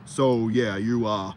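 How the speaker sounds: low, forced deep voice pushed from the throat; mock a podcaster faking a deep voice; fake deep throat voice. Mocking podcast guys, fake deep throaty voice. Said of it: "This is how y'all be sounding".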